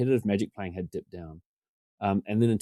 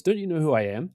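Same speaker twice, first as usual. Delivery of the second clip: tongue in cheek; 7 semitones higher than usual. Retold or imitated. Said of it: "kind of being tongue in cheek".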